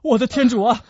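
high, panicked shouting; loud, urgent distress cries; crying for help. Acted. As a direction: call friends over in frantic alarm. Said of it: "在博尔多斯高声呼救的时候".

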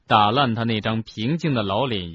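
ordinary delivery, nothing marked

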